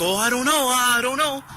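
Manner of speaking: high, dithering imitated girl voice; flustered girlfriend. Girlfriend dodging the clerk's question, high flustered mock voice.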